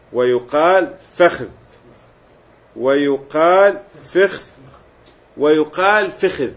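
each فخذ given a different vowel, clearly sounded; teacher listing the readings of فخذ; pronunciation demo. Shown as a demonstration. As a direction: sound out every variant of فخذ distinctly. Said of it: "أربع لغات".

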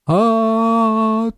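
stretched, slow, warped far-off call; distorted voice. Acted. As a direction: sound distant and warped. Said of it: "растянутый, сильно искажённый голос".

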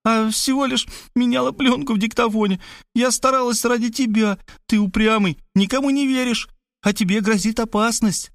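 high-pitched crying plea; hammed-up weeping sister; tearful. A narrator's reading. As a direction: justify yourself through mock tears. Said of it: "заплакала сестра".

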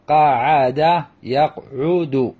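قعد يقعد slowly as qa-'a-da, yaq-'u-du; teacher's drawn-out model of قعد يقعد; syllable by syllable. Shown as a demonstration. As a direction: sound out قعد يقعد with each syllable held. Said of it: "مثال آخر".

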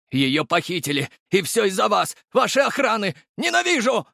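high-pitched outburst building to hatred; distraught father's rising rant; furious accusation. A narrator's reading. Desperate father blames the hero.

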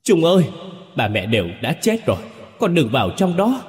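urgent scream. A narrator's reading. Mother warns Trung away from the fire, urgent scream. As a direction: cry out high to stop him, hurrying. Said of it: "Bà Hai vội hét lên".

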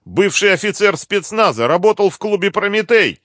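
high, loud voice under pressure; blurted answer. A narrator's reading. Captive informs on accomplice to colonel, blurting. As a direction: blurt it out to save yourself.

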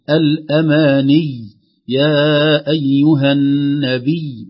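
slow, loud chant stressing "niyy" in "الأماني" and "biyy" in "النبي"; doubled final ya. Shown as a demonstration. Sheikh models both words, "النبي" with doubled ya. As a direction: hold a tense doubled y ending "الأماني" and "النبي". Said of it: "الياء هنا ياءان".

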